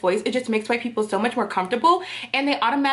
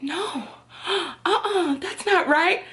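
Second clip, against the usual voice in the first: shocked objection; 8 semitones higher, slower than usual. Retold or imitated. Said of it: "and they were like".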